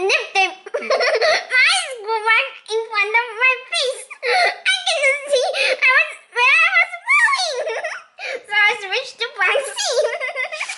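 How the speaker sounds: high, breaking into laughter; fighting giggles to get the words out; laughing. Real, not acted. Reader cracking up at the bag-rolling mishap, laughing.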